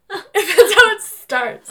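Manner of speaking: laughing through the words, high; giggling as she explains. Real, not acted.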